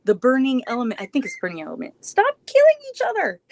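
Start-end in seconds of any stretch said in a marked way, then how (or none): 2.0-3.4 much higher, louder call to the dogs; laughing high call at the play-fighting dogs. Real, not acted. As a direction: shout to break up the puppies.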